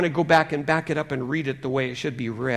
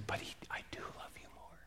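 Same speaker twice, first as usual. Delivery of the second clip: gentle whispered reassurance; very soft, hushed whisper; little inner voice; 4 semitones higher, 13 dB quieter than usual. Acted. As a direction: whisper it like a voice inside the heart. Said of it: "this little voice says".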